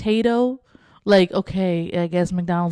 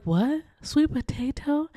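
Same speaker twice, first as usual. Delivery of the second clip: high, slow incredulous question; mock disbelief; 7 semitones higher, slower than usual. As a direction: sound baffled at the sweet potato. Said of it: "you'd be like".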